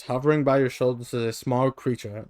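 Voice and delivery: ordinary talk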